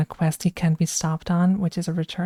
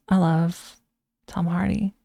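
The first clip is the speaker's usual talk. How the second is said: ordinary delivery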